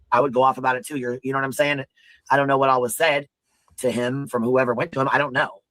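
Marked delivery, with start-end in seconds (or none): none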